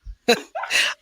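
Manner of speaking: a short breathy laugh. Real, not acted. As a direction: let out a quiet unvoiced laugh. Breathy laugh about horrible day.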